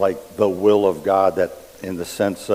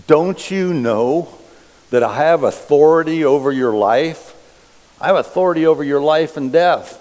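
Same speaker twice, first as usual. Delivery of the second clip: high, loud, demanding governor; frustrated Pilate; 7 semitones higher, 7 dB louder than usual. Acted. Pilate pressing the silent Jesus, loud and demanding. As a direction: press him hard like an angry Roman ruler.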